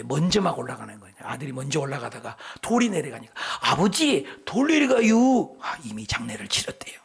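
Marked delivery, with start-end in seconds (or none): 3.6-5.6 high, slow drawn-out call to father; 느릿한 충청도 사투리; slow Chungcheong drawl. Retold or imitated. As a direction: stretch every syllable like a lazy Chungcheong son. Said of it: "아주 느리다고 그러는데".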